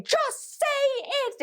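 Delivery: very high, drawn-out mock scream. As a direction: screech it, stretching each word. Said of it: "a long time of me screaming".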